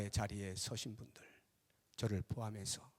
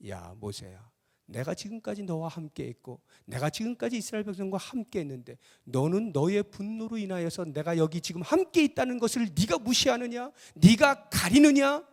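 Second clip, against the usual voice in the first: acted stern reproach rising to an outcry; building divine rebuke; 8 semitones higher, 8 dB louder than usual. God rebukes Moses, building. (점점 격해지는 꾸짖음)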